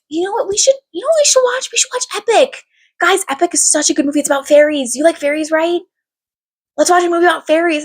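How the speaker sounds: high, sing-song sitter voice; hammed-up high-pitched persuasion; coaxing little kids. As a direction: sell it sweetly to small children. Coaxing little girls toward Epic.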